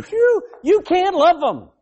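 whew, high and loud, then slow; exasperated exclamation. Real, not acted. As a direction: throw up your hands at it. Preacher exclaims about angry people.

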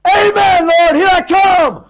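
very high, loud, drawn-out cry; exultant shout. Retold or imitated. Pastor in a headlock calling to God, triumphant yell. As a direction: yell it to heaven at full voice.

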